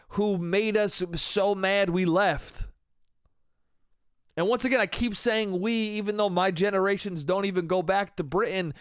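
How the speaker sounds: ordinary talk, nothing marked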